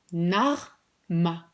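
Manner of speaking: Narma said as a puzzle, syllables of Manar reversed; name in verlan. Shown as a demonstration. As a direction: say Narma teasingly, backwards. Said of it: "je vais vous le dire, mais à l'envers".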